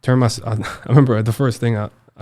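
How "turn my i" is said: usual speaking voice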